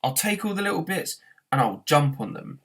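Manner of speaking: high angry shout; running rant. A narrator's reading. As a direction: keep ranting. Continued rant.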